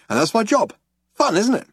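bright and pleased with himself; tossed off gleefully; cheerful. A narrator's reading. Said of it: "Ford's cheerful boast".

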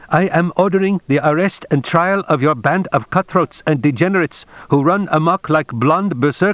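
high-pitched ranting order, no real volume; understated narrator's shout, hysterically indignant; mild shouted tirade. A narrator's reading. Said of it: "Mister Tagomi shouted".

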